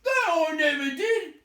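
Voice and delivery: loud, high denial; angry troll denial. A narrator's reading. Tom denying it, loud and high. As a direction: shout it back. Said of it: "said Tom".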